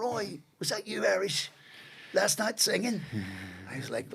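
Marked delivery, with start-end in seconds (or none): 0.0-3.0 high-pitched voice of a fellow inmate; prisoner calling out. Retold or imitated. Other prisoner asking the Irish newcomer about singing, calling out. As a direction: call over lightly from the next cell, pitched up.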